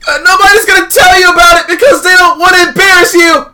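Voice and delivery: exasperated game hero lecturing a friend; exasperated protagonist. Acted. Voices the game's protagonist.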